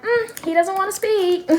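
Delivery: a hint of a sing-song reply; mild high mock voice. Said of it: "I be like".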